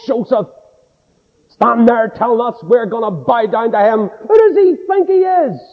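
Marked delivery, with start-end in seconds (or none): 4.1-5.6 high, scornful jeer; mock him like a jealous brother; sneering brothers.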